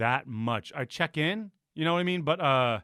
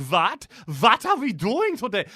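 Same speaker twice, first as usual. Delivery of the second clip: higher, louder mock German English; exaggerated German accent; 6 semitones higher, 4 dB louder, slower than usual. Mocking how Germans supposedly talk, heavy German accent. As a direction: overdo the German accent for a laugh. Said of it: "Germans are not like".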